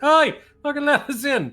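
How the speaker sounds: high, loud yell to be let in; shouting at the gates. Acted. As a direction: holler up at the gatekeepers. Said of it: "people shouting at the gates".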